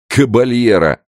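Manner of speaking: loud, high derisive echo; scornful snort. Acted. Gendarme mocks the old man's title, scornful snort. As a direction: snort the title back with contempt. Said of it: "фыркнул другой жандарм".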